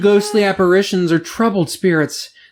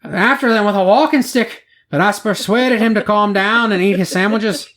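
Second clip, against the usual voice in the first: a lightly put-on Scottish accent, pitched up; amateur Scottish brogue for a news quote; 5 semitones higher than usual. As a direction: read the quote in a mock Scottish voice. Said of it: "that was Scottish, not English".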